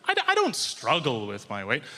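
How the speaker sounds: ordinary talk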